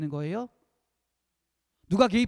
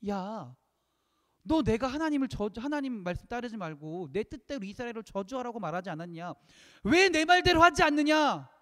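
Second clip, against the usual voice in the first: angry rebuke; 4 semitones higher, 4 dB louder than usual. Acted. Balak blaming Balaam for not obeying him, angry rebuke.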